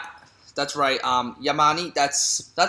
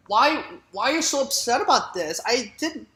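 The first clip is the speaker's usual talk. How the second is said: put-on higher, puzzled girlish voice; confused niece; 8 semitones higher than usual. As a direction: play the baffled niece, pitched up. Niece confused by aunt's anger.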